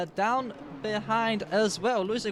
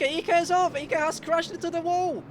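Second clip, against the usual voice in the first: high, loud crash call; excited shout; 7 semitones higher, 3 dB louder than usual. Real, not acted. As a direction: yell the crash as it happens. Commentator calls a crash.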